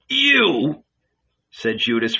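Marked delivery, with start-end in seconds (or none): 0.1-0.8 high, stunned outburst; recoiling in shock. A narrator's reading. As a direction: jump up in pitch, aghast.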